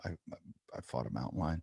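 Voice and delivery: low, soft humblebrag; mock-nonchalant, quiet and low; pretend casual brag. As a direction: play it cool to impress someone at a bar. Imagined kid bragging in a bar.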